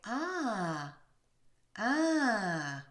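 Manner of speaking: open, rounded French ah with a relaxed tongue. Shown as a demonstration. Teacher demonstrating the open round vowel to learners. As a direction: shape the mouth round and say ah. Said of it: "as if to say".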